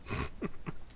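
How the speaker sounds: soft unvoiced chuckle; a quick airy laugh to yourself; breathy laugh. Real, not acted. Gamer amused at his own bit, soft airy laugh.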